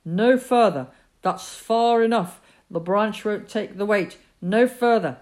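father shouting up to stop his son; sharp shout. Acted. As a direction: call a halt with force. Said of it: "his father's shout was sharp".

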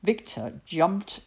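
usual speaking voice

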